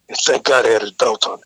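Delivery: ordinary talk, nothing marked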